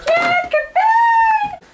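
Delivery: high, slow, anguished shout; dramatic cry. Acted. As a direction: wail it like a film farewell. Dramatic slow cry after Jack.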